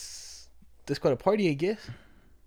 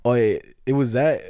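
normal talking voice

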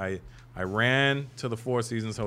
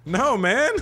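put-on eager kid voice; 12 semitones higher, 9 dB louder than usual. His younger self voiced.

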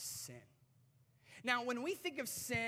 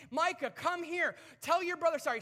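higher, loud scolding call; exasperated dad; 9 semitones higher, 11 dB louder than usual. Retold or imitated. Fed-up dad ordering Micah to apologize, exasperated. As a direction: bark the order at a misbehaving kid.

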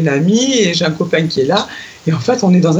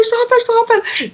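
fast and high, rushing off the line; rushed hang-up; much faster than usual. Retold or imitated. To a friend on the phone, hurrying to free the line, fast. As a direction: gabble it to hang up quickly. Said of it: "je fais".

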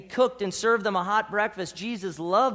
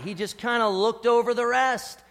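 ordinary talk

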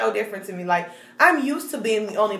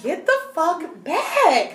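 slow, high-pitched retold protest; exasperated rebuff; 6 semitones higher, slower than usual. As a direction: stretch out an exasperated back-off demand. Acted demand to back off.

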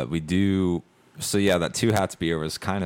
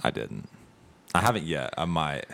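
usual speaking voice

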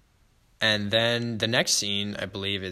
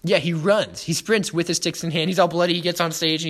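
louder and higher, swept up in it; excited retelling; 6 semitones higher, 5 dB louder than usual. Real, not acted. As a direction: tell it breathless with excitement.

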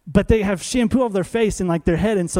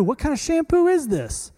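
mock baffled complaint; 9 semitones higher than usual. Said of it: "He's mad at the shampoo".

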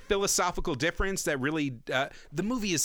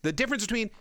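ordinary delivery

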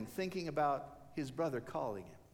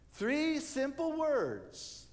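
slow emphasis; 9 semitones higher, much slower than usual. Acted. Preacher stressing the three words.

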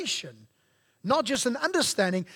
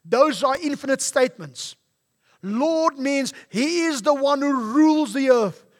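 preaching at full force; 5 semitones higher, 7 dB louder than usual. Acted. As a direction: build it up and proclaim to the congregation. Pastor rises to full preaching.

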